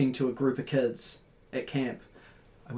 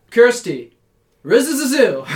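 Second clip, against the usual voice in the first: put-on high, loud garbled kid's holler; screaming it down; 8 semitones higher, 9 dB louder than usual. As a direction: imitate a kid yelling nonsense from up high. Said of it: "She was, like, screaming it down".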